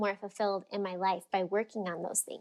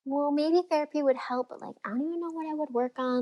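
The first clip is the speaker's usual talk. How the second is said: higher, lightly voiced past self, unsure; a hint of her lost younger self; retold unsure inner voice; 6 semitones higher than usual. Recalling her old doubts about therapy, lightly unsure. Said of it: "I was like".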